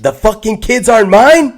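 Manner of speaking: loud, high-pitched disbelief; mock outrage. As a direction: blurt it like a betrayed husband. Acting out the betrayed husband's shock.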